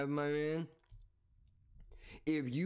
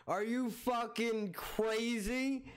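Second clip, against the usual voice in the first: slow, higher mock disbelief; mock outrage; 6 semitones higher, slower than usual. Streamer mocking the guest's confidence in fake disbelief.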